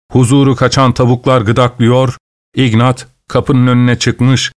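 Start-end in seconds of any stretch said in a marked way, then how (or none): none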